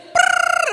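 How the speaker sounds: very high, loud gibberish trill; mock rapid Spanish. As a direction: rattle off fake fast Spanish. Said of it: "That's my interpretation of Spanish really fast".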